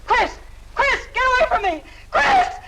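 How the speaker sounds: shrieks climbing far up in pitch; terrified screaming. Acted. Woman fending off Chris as he attacks, terrified screams. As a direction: scream his name in mounting terror.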